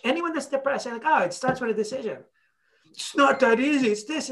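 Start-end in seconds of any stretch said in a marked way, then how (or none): 1.0-4.3 higher-pitched, touchy imitation; mad mock retort. Depressed person rejecting that it starts with a decision, mock indignant. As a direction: mimic someone bristling at advice. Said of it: "Like, why are you mad?".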